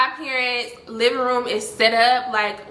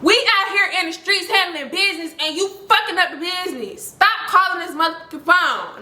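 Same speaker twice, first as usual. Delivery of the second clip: high, emphatic retold telling-off; scolding; 7 semitones higher than usual. Storyteller replays ordering the caller to stop phoning, scolding. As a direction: lay down the law, pitched up.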